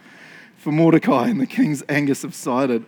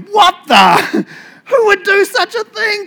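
high, loud, incredulous royal outburst; theatrical shrieked outrage; outraged demand; 11 dB louder, slower than usual. Acted. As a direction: shout it as a scandalised king, hammed up. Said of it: "King Xerxes demanded".

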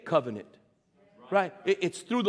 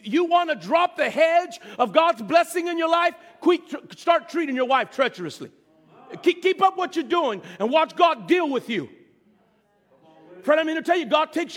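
fiery preaching; 5 semitones higher than usual. Real, not acted. Preaching at full force.

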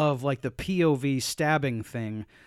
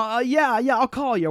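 smug; 7 semitones higher, 3 dB louder than usual. Retold or imitated. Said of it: "He's got this smug look on his face".

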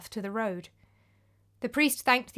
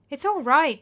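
higher and louder, eager to reassure; encouragingly; 4 semitones higher, 7 dB louder than usual. Acted. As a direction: coax him to take the bread. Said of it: "said Paschasius encouragingly".

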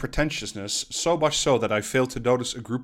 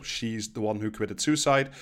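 ordinary talk

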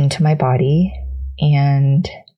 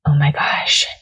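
tearful whisper. Real, not acted.

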